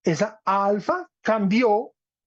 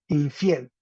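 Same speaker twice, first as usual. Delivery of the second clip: usual speaking voice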